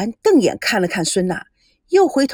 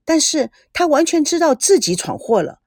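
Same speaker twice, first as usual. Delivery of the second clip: ordinary delivery, nothing marked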